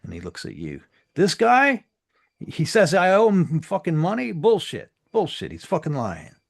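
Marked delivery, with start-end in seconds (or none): 1.2-6.3 high, loud outraged shout; furious accusation. Acted. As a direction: point and yell.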